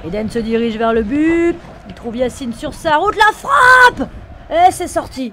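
live football call rising to a shout; excited sports commentary. Acted. Play-by-play of a demo match.

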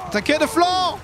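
high shouted command; shouting orders over battle. Pretend. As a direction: yell it across the battlefield. Yelling orders in battle game.

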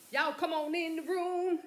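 high, loud call into the room. Real, not acted. Calling viewers into room.

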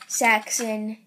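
ordinary delivery

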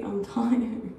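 ordinary delivery

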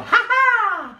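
high, drawn-out witch laugh; acted high-pitched cackle; witch's laugh. A narrator's reading. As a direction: throw out a high ha-ha. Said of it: "The witch laughed out loud".

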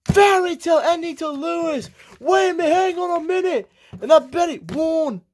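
very high, racing hype call; frantic commentary. Acted. Commentator calling Bendy's sneaky win, high and frantic. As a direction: scream the finish to the count. Final sequence, commentator screaming the finish.

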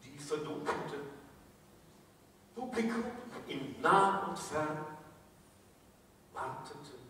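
hushed mock-solemn Christmas poem; comic poem recital. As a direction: let each short phrase drop softly, with long pauses.

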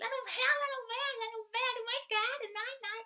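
put-on high, loud, playful girl's squeal; teen girl squealing. Teacher voicing a seventh-grade girl, high loud playful squeal. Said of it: "hvis vi nu tager en pige i syvende klasse".

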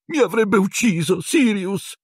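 high, squeaky wail; whimpering Pettigrew. A narrator's reading. Pettigrew fearing for his life before Sirius, high whimper. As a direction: whine the excuse pitifully. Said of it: "piagnucolò Pettigrew".

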